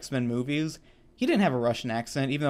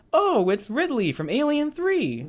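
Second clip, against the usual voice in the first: much higher, slow mock Deadpool voice; Deadpool quip; 12 semitones higher than usual. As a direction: squeak the jab out high and slow. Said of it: "Deadpool perfectly describes her as".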